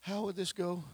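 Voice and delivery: high, slow whining plea; whining Mary. Acted. Preacher acts Mary whining to Jesus, high and slow. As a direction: whine it like a hurt sister, pitched high. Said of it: "She whined".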